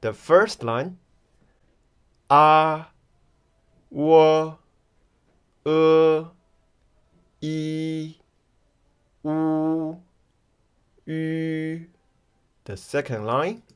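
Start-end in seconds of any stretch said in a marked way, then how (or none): 2.3-11.9 A Wo E U Yu held long on a level tone; each Mandarin final sung out evenly, separated; Pinyin pronunciation demo. Shown as a demonstration. Teacher tries the list of mothers, Pinyin demo. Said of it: "Shall we give them a try".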